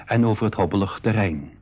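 slow, even storyteller's read; audio-drama narrator; story narration. A narrator's reading. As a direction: narrate calmly to the listener. Narrator tells the journey.

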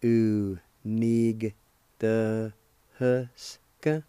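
"U", "nig", then a slow drawn-out "Unigtahusca"; slow syllables. Shown as a demonstration. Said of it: "you can add the G sound to the N I like this".

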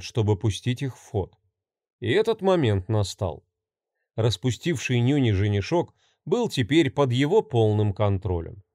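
ordinary delivery, nothing marked

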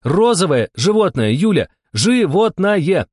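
high, loud, drawn out on the last word; exasperated insistence. A narrator's reading. Steklova to Yulia who misses the clue, exasperated. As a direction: hammer it home, stretching the final word slowly. Repeats clue to clueless partner.

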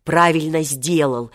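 ordinary delivery, nothing marked

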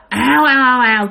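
mock whoosh of a charging pack; whooshing rush. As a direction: sweep the sound up like a pack charging. Dogs rushing up from the field.

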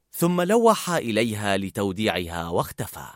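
ordinary delivery

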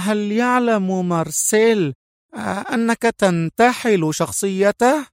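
high voice, veiled threat; threatening tone. Acted. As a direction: threaten while faking calm. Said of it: "لهجة تحمل صيغة الإنذار والوعيد".

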